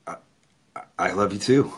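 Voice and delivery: stammering, rising to a question; halting reply, caught off guard; surprised. Retold or imitated.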